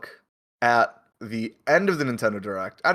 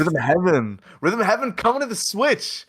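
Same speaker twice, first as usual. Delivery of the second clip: excited; 7 semitones higher than usual. Real, not acted. Hyping announcement.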